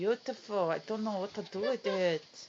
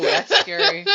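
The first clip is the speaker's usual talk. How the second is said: laughing; 12 semitones higher, 15 dB louder than usual. Real, not acted. Laughing at the hair.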